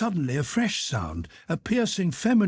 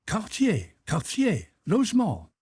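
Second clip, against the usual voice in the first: good-natured French officer; 9 semitones higher than usual. A narrator's reading. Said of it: "condescending and good-natured smile".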